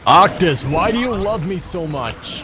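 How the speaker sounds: slow, booming trailer announcer; big dramatic voice-over delivery; movie trailer voice. Real, not acted. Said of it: "I feel like I'm in a movie trailer when you're speaking".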